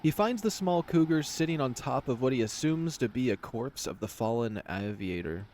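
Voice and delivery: ordinary delivery, nothing marked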